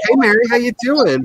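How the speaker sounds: bright, high, loud hello; cheery greeting. Retold or imitated. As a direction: call out like greeting an old friend. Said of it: "I just wanna be like".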